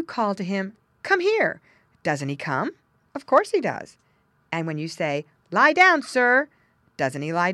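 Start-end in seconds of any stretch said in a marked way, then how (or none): 1.0-1.6 high, loud call to a dog; sing-song dog call. Retold or imitated. Calling a dog. 5.5-6.5 loud, high command to a dog; firm loud dog command. Retold or imitated.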